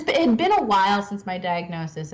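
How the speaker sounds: ordinary talk, nothing marked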